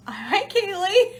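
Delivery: high, loud, slow hello; excited greeting. Real, not acted. Greeting a viewer in the chat, excited high hello. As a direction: sing out a cheery hello.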